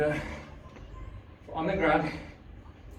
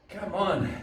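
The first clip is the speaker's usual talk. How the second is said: usual speaking voice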